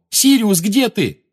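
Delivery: high, loud anxious calling; panicked shout. A narrator's reading. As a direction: call out for him, afraid. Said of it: "крикнул он".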